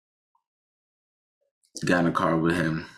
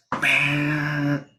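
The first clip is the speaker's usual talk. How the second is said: a sharp, high imitated bam; brake slam sound; 10 semitones higher than usual. Storyteller recreating the brake slam, sharp imitated bang. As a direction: punch out the noise of the brakes.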